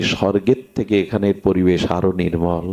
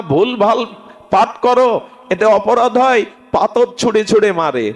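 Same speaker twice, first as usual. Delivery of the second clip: preaching at full force; 10 semitones higher than usual. Acted. Preacher urging the congregation to worship Gaura, impassioned. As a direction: rouse the crowd to worship Gaura. Telling story of scolded reader.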